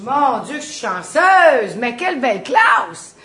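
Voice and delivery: loud, slow, sarcastic cheer; mock delight. Teacher feigning joy at the toughest class, loud and slow. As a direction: pretend to be thrilled, dragging it out.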